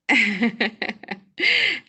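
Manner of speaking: high, loud chuckling burst; amused laughter. Real, not acted. Signing off to the listeners, high loud laughter.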